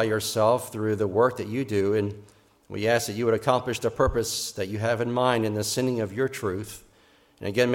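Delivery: soft, low-pitched public prayer. Real, not acted. Pastor praying to God before the Christmas sermon, hushed and low. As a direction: lead the congregation humbly in prayer, quietly.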